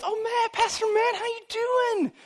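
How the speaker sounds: high, drawn-out gushing greeting; gushing churchgoer. Acted. Churchgoer greeting the pastor on Sunday, gushing. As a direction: put on a squealing, over-friendly church greeter.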